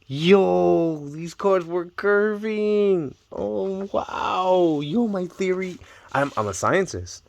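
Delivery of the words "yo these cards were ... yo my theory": amazed. Real, not acted. Amazed at the curving cards.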